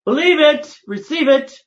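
high, loud exhortation; preaching shout. Real, not acted. Speaker urging his audience to believe, loud preaching cry. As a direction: proclaim it to the crowd.

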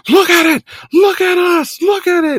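high, loud mock wrestler rant; imitated unhinged yelling. Host imitating a bloodied wrestler's belt interview, frantic yelling. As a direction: yell it wildly like a crazed champion. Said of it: "and he's yelling".

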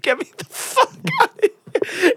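high, laughing begging; squealing with laughter, half-begging; laughing plea. Retold or imitated. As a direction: laugh through a mock desperate plea. Retold horror, laughing.

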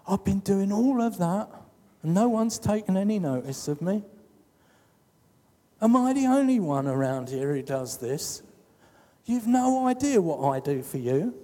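a hint of a sulky martyr; whiny complaint. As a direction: whine like the put-upon one at home. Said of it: "How many times have you maybe said".

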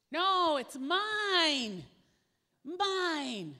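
high, loud, very slow refusal; greedy child. Retold or imitated. Believer refusing to give God a portion, high and loud. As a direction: hold on to it like a spoiled kid.

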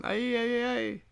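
high, mock-Mexican "ay ay" cry; horrible Mexican accent. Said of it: "That's a horrible Mexican accent".

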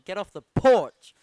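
loud, slow, drawn-out imitation of the coach's pep talk; fired-up quote. Podcast host quoting a player's pep talk, loud and slow. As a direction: belt the porch line slowly like a fired-up player. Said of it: "I think that might be my favourite quote".

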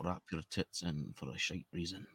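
low, slow, soft Scottish accent. Shown as a demonstration. Co-host practising what Scottish workmates taught, quiet Scottish accent. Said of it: "Scottish guys I work with".

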